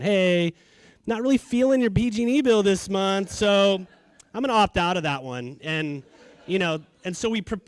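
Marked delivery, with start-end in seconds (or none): 0.0-5.6 higher, louder breezy mock voice; a kid pretending to skip the bill, mocking; mock letter to the utility. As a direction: play it like a cheeky customer brushing off the bill. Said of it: "write P G and E a letter saying".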